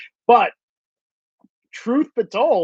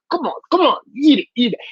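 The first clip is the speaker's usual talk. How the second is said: playful goading; 4 semitones higher, faster than usual. Acted. Acting out goading guest to eat.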